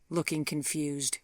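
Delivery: ordinary delivery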